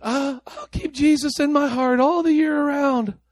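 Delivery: high, slow, airy mock of a casual believer; mock complacent churchgoer. Preacher mocking people who keep Jesus in their heart, high and slow. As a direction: send up the easy-going believer, drawn out. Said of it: "People said".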